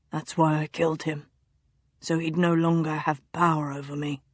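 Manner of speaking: low, soft secretive murmur; delirious duke whispering a childhood secret; feverish duke. A narrator's reading. Feverish duke's voice.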